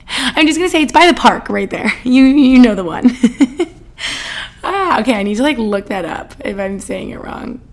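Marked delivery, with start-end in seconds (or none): 3.1-4.6 a breathy giggle up high; high embarrassed laugh. Real, not acted. Vlogger laughing at her own Boston slip, giggling.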